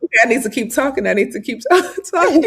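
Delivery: fast panicky self-talk; rushing, half laughing; flustered inner voice. Retold or imitated. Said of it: "I was like".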